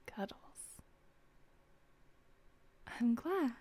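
soft breathy whispering close to the ear; intimate whisper. Acted. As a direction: whisper tenderly, drawing them in.

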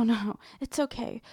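usual speaking voice

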